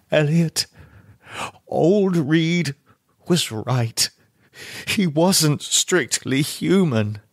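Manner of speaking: ordinary delivery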